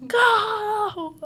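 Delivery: long, high-pitched yell; yelled cheer. Retold or imitated. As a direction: imitate a drawn-out sideline holler. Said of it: "So then we started yelling".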